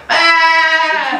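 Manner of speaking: comic bleat that gets the room laughing; sheep bleat. Retold or imitated. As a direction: bleat it like a sheep squeezed thin. Imitating sheep in funny video.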